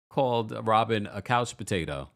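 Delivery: ordinary delivery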